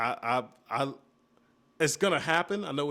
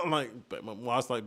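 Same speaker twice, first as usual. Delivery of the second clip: usual speaking voice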